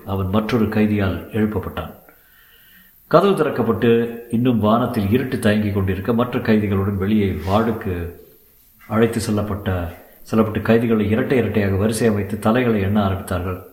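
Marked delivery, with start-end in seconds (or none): none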